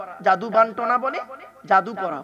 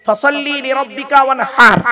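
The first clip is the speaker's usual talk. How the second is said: recited demo; 2 semitones higher, 7 dB louder than usual. Shown as a demonstration. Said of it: "ভালো করে উচ্চারণটা শিখে নিন".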